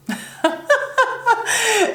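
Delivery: bright burst of laughter; real amused giggle; high giggle. Real, not acted. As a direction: laugh at yourself.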